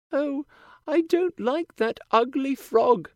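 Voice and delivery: put-on high, bright girlish princess voice; cartoon princess. A narrator's reading. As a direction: play a sweet young princess. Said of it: "she tells him".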